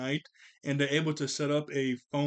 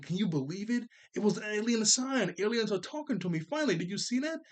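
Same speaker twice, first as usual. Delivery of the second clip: much higher, thrilled; excited Dill; 6 semitones higher than usual. Retold or imitated. Dill telling Tommy aliens finally talk, thrilled. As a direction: bubble over with wonder.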